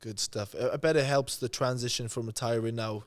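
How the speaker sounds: normal talking voice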